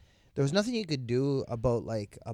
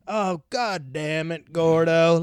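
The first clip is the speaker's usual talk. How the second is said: weary exasperated cop; 4 semitones higher, 7 dB louder, slower than usual. Retold or imitated. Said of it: "they're like".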